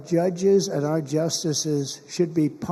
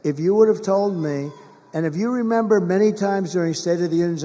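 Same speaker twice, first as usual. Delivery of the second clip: ordinary talk, nothing marked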